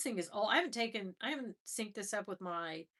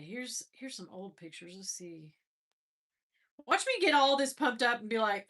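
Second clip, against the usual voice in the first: normal talking voice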